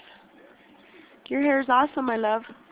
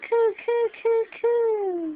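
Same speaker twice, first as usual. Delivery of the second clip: cooing noises, pitched up; high cooing; 6 semitones higher, slower than usual. Real, not acted. Cooing to make him talk.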